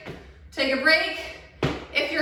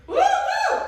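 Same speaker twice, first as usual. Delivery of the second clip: excited whoops; 4 dB louder than usual. Real, not acted. Cheering during exercise.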